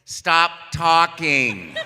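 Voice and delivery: loud slow stop call; mock shout. Producer ending the audition, mock loud shout. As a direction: call cut over the laughter. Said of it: "until I finally heard".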